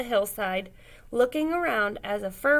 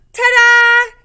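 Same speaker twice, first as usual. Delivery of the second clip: very high, loud showy reveal; big ta-da; 13 semitones higher, 15 dB louder than usual. A narrator's reading. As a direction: unveil it like a magic trick. Grand reveal of turkey.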